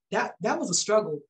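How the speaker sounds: usual speaking voice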